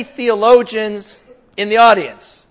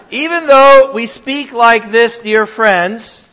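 reads the verse with a laugh, louder and slower; laughing while reading; 3 semitones higher, 7 dB louder, slower than usual. Real, not acted. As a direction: chuckle through the scripture line. Reading scripture, laughing warmly.

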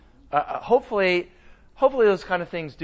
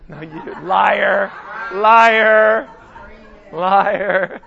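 laughing accusation; 3 dB louder, much slower than usual. Real, not acted. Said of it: "liar (laughing)".

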